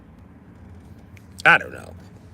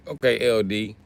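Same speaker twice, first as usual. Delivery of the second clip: ordinary talk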